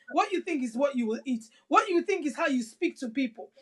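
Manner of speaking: normal talking voice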